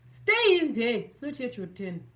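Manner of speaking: loud, high mock scold at her hair; playful loud order to stubborn hair. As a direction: order the hair to stay put. Scolding her hair to stay put.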